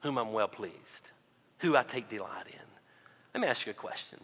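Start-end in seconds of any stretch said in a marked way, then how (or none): 0.0-2.6 God the Father's words. Acted. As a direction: quote God softly, with long pauses. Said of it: "God the Father says".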